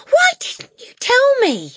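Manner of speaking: put-on high, loud, drawn-out child's protest; wailing cry. A narrator's reading. Matilda reproaches her teacher, wailing high and loud. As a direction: wail it slowly as a reproach. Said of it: "Matilda cried".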